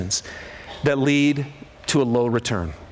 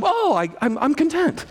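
mock smug content; 11 semitones higher than usual. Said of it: "You're not just like".

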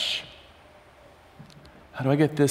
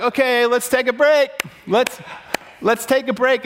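retold call for a break; 10 semitones higher than usual. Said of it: "And I'm like".

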